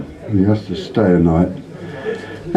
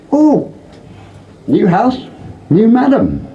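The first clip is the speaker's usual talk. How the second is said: squawky parrot; 12 semitones higher, slower than usual. Acted. Parrot greeting new owner in high squawk. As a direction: squeal it out like a brothel parrot.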